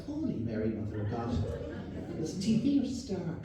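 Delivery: lightly voiced shocked Irish local; Irish villager. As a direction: gasp like an old Irish villager. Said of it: "they're like".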